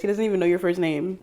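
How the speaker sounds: ordinary talk, nothing marked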